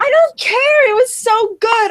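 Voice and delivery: high, loud outburst; loud protest. Real, not acted. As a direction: shout it in indignant protest. Friend told not to share.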